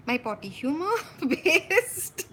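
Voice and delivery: laughing. Real, not acted. Laughing at own joke.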